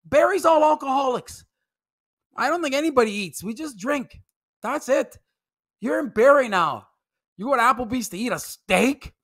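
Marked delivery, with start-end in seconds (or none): none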